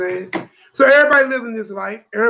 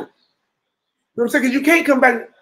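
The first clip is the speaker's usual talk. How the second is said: ordinary talk, nothing marked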